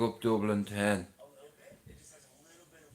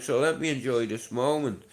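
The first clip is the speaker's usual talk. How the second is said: ordinary talk, nothing marked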